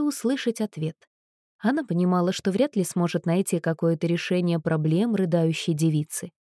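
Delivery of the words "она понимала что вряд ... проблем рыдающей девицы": usual speaking voice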